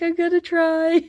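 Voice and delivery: high-pitched mock defensive reply; mock protest. Streamer retelling her answer to the editor, mock protest.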